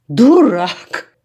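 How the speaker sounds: loud, falling Дурак! flung as an insult; angry cry. A narrator's reading. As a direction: bark Дурак! right at him. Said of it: "скрикнула Янка".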